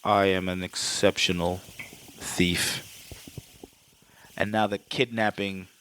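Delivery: put-on low, deliberate villain in Hans's accent; deep, softened attempt at Hans's accent. Hans correcting Holly about being a thief, low accented impression. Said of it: "I lost the fucking accent".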